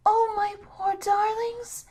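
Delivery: put-on high, slow, pitying old grandmother; an old woman's pity for the children, drawn out high. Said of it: "pitying the children".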